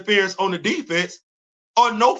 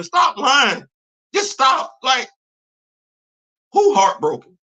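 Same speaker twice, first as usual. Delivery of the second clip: exasperated shout; 4 semitones higher than usual. Real, not acted. Scolding fans.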